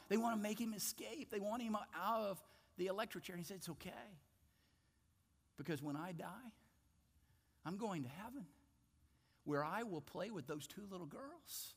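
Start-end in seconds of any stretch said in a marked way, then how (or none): 3.6-11.7 soft reassurance. Retold or imitated. Coffey explains he's going home.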